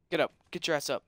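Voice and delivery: ordinary delivery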